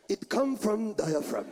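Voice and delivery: low, slow mock Japanese male accent; hammed-up deep Japanese man. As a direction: push it from the diaphragm in a Japanese accent. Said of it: "When a Japanese man talks".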